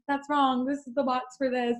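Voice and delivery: flustered apology. Retold or imitated. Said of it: "And so I was like".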